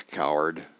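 low, dry one-word jab; a lightly voiced wife calling him coward; wife's dry put-down. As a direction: drop the voice and sneer it. Wife's dry reply to husband.

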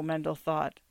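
ordinary delivery, nothing marked

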